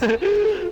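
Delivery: shrieking laugh; high burst of laughter. Real, not acted. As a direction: laugh out in surprise. After being hit by train.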